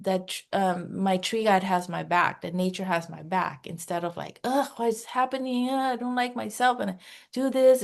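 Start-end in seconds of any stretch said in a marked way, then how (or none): 4.4-7.8 higher, quick exasperated whine; mock inner fretting. Coach mimicking her old ego mind, mock fretting. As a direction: imitate a panicky self-critical inner voice.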